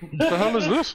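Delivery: high-pitched comic disbelief; mock bafflement. Listener hearing the original song, baffled. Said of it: "you'll be like".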